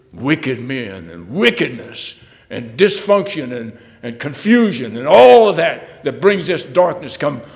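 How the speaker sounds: loud and high, building to a fast finish; rising pulpit warning of wrath; fiery preaching. Acted. Preacher warning the flock that God will act, fiery build.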